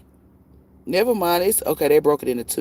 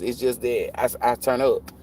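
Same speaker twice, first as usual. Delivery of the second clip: ordinary talk, nothing marked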